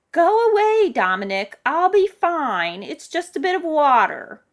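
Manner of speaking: put-on high, loud, dismissive old lady; shrieky old-woman voice, drawn out; stubborn old woman. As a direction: shoo someone away as a cranky elderly neighbor. Stubborn elderly neighbor shouting back.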